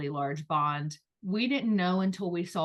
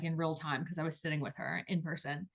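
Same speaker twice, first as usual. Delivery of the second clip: usual speaking voice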